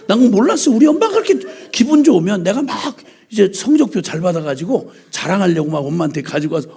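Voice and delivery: ordinary talk, nothing marked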